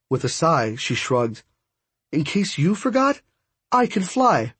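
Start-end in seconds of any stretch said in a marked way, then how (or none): 2.1-4.5 high-pitched echo, rising on "forgot"; teasing one-upmanship. Acted. Friend topping the godborn's boast, teasing. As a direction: throw the boast back, pitched well up.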